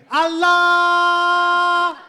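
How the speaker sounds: long, high, full-volume holler; shouted alarm. Shown as a demonstration. Comic shows how to clear a fight in two syllables, loud drawn-out shout. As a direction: stretch it out in a piercing yell.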